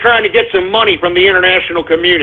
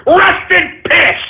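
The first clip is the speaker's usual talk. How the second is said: high, slow mocking yell of rest in piss; gloating shout; 10 semitones higher, slower than usual. Real, not acted. Host gloats to listeners over dictator's death, high slow shout.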